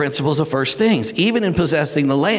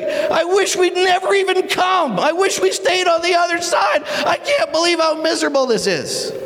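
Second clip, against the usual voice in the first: wailing complaint. Acted. Leader regretting they ever came, wailing in complaint. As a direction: cry out in hammed-up misery.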